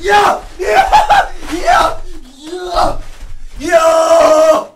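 high, loud triumphant yells; goal celebration. Real, not acted. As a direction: shout for the goal.

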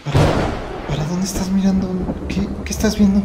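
hushed and spooked; scared. Real, not acted. Vlogger spooked by the dog staring at nothing, hushed. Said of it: "Me estás asustando".

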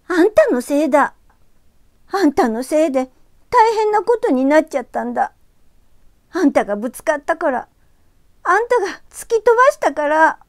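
high, loud furious accusation; furious girl. Acted. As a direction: blame him with rising fury. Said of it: "圧倒的な怒りがこみ上げて". (怒りを爆発させる少女の声)

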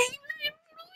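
hushed whispered aside. Real, not acted. Player whispering that Jerry has four hundred one euros, hushed. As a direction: whisper it like a secret.

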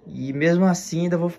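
ordinary talk, nothing marked

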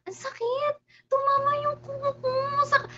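high, hurting whine; pained whimper. Real, not acted. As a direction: nurse a sore fingernail aloud.

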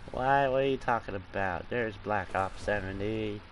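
ordinary talk, nothing marked

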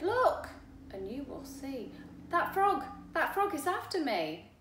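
high, loud frantic warning; alarmed fly. Acted. Fly warns the boy about the frog, frantic high cry. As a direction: point and shout about the frog.

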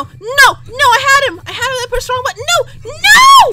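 high, loud outburst, peaking in a yell; upset protest. Real, not acted. Gamer pushed the wrong button, high upset protest. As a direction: wail in protest at your own mistake.

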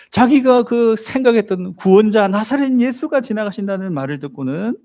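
ordinary talk, nothing marked

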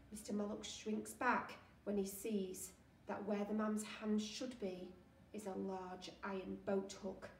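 ordinary delivery, nothing marked

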